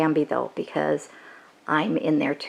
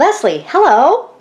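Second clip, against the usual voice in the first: higher, louder happy hello; excited greeting; 10 semitones higher, 8 dB louder than usual. Real, not acted. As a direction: greet her brightly. Greeting arriving friend.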